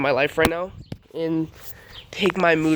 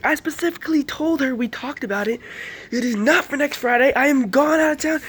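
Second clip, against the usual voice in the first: dad going off; 9 semitones higher than usual. Retold or imitated. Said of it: "He's kinda going off on her".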